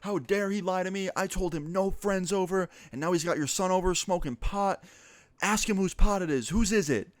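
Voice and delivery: imitating a screaming mom over the phone; mock screaming rant. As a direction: rant like a livid parent dishing out punishments. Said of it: "She starts screaming".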